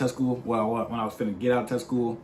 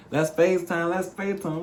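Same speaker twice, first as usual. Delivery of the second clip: higher-pitched mock girlfriend voice; imitating pestering girlfriend; 6 semitones higher than usual. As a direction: lightly mimic her eager request, pitched up. Imitates girlfriend pestering him to FaceTime.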